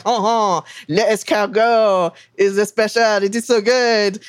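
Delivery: lightly put-on French accent, a bit slow; hint of a haughty French waiter pushing snails; snooty French maitre d'. Maitre d' upselling snails, mild mock French accent. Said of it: "the snooty French maitre d' would be like".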